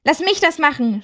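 high, pushy yell; shouting. Acted. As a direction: insist at the top of the voice. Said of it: "schrie er".

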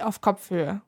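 ordinary delivery, nothing marked

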